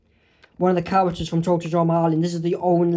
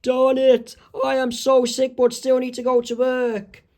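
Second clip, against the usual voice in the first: complaining sick man; 6 semitones higher than usual. Acted. Sick Homer still has to go to work, high-pitched complaint. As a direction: moan it out in a raised voice.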